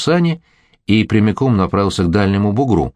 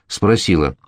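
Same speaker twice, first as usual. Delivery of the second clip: usual speaking voice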